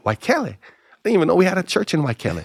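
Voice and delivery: high-pitched incredulous repeat; baffled echo. Retold or imitated. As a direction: echo it high, as if never heard of it. Baffled echo of pastor's answer.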